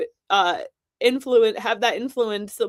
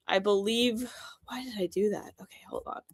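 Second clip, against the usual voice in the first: breathy under-the-breath whisper; whispered aside; 10 dB quieter than usual. Real, not acted. Host whispering to himself after a slip, hushed whisper. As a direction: mutter it off-mic to yourself.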